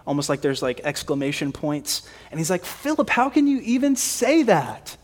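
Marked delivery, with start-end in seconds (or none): 2.6-5.0 high, incredulous rebuke; acting out a teacher's disbelief at a student, pitch jumping up; exasperated. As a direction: ask it like there are exclamation points after it. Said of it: "Jesus is maybe a little bit exacerbated".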